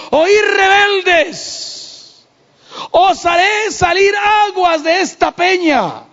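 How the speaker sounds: high, slow furious shout "Oí rebeldes"; angry shout. Acted. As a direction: roar it at the rebels, drawn out. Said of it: "estaba tan enojado que le dijo".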